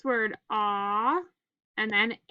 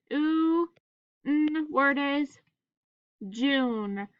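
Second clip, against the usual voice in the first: normal talking voice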